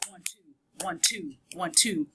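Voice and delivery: "one, two" in even pairs, weight on "two"; iambic beat. Shown as a demonstration. Teacher shows the poem's beat, "one, two" stressing "two". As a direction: count out "one, two" like a beat, second one stronger. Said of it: "that has this beat of this".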